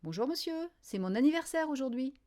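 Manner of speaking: high-pitched schoolgirl announcement; eager greeting. A narrator's reading. Birthday girl answering her teacher, eager greeting.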